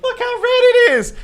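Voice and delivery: put-on very high, loud childish whine; kid complaining. Kid showing his mom his red backside, high whine. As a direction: show off the welt like a hurt kid.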